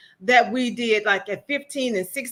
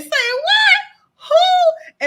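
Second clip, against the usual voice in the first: much higher and louder shocked cry; imitating a stunned aunt's outcry; shocked shriek; 8 dB louder, much slower than usual. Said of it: "She was always saying".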